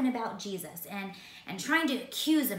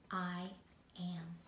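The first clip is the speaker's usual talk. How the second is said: softer, lower, calm reply; quiet answer; 3 semitones lower, 11 dB quieter than usual. Acted. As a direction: say it softly and simply. Jesus answers the high priest.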